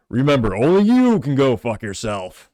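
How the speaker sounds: slow, loud, booming bear mascot; forceful parody of a safety-ad bear; Smokey the Bear. Acted. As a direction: do a slow, emphatic cartoon bear voice. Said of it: "moderately good Smokey the Bear impression".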